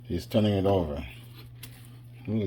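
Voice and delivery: ordinary delivery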